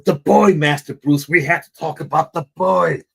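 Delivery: a hint of a cross butler, pressing; lightly voiced Alfred, firm and urgent; mock angry butler. Butler confronting Bruce about the boy, lightly voiced annoyance. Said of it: "Alfred just gets pissed off".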